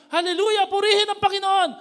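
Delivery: high, loud joyful crowd cry; crowd shout. Retold or imitated. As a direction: cheer it like the welcoming crowd. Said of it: "the people were shouting".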